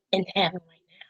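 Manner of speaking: breathy hushed whisper, almost no voice. Real, not acted. Vlogger honouring late wrestler, hushed whisper. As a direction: drop to a whisper as if speaking of heaven.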